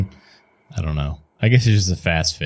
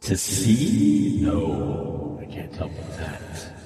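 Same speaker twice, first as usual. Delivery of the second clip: slow, hesitant otherworldly voice; eerie voice, about to leave; mysterious unseen stranger; 3 semitones lower, 6 dB quieter, much slower than usual. Acted. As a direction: offer help as an eerie stranger. Game master voices the unseen stranger.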